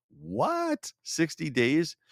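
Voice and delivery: high squeaky disbelief on what; sixty days? rising in pretend disbelief; mock surprise. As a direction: play the shocked listener, pitch way up. Mock listener reacting to the statistic.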